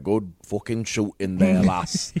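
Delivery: put-on gruff northern dad accent. Ned praising Arya's archery, northern accent. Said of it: "That's exactly how they talk".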